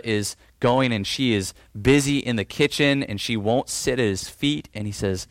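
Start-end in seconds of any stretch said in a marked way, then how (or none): none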